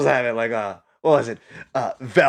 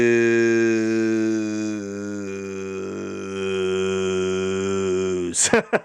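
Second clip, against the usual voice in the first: long laugh; 5 semitones lower than usual. Real, not acted. Laughing at own bit.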